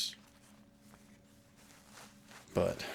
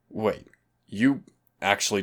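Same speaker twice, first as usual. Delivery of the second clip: sudden surprise; 3 semitones higher, 6 dB louder than usual. Real, not acted. Shocked partner doesn't know.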